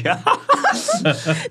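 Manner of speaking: big open laugh, falling away; loud laugh. Real, not acted. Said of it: "你不要笑那么大声".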